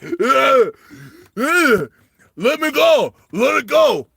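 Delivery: high, loud straining shout; Sylvester Stallone. Acted. Climber held back by two companions, hammed-up struggling yell. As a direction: grunt and bellow, struggling to break free. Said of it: "And Sylvester Stallone's like".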